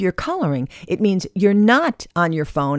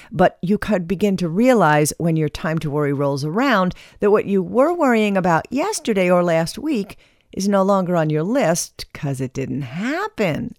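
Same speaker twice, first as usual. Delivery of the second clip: ordinary delivery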